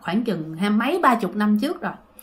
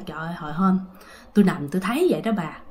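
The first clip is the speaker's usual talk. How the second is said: hushed fear; 5 semitones lower, 5 dB quieter, faster than usual. Retold or imitated. Grandpa fearfully recounting to grandma.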